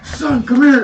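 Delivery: loud, high holler for his son; calling out. Retold or imitated. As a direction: imitate a dad yelling for his kid. Dad calls son upstairs.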